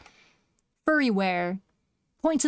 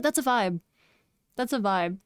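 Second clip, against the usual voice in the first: ordinary talk, nothing marked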